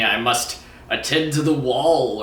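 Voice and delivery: slow, hammed-up Game of Thrones accent; a clumsy stab at a Westeros accent, drawn out. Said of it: "Oh, I can't do that accent".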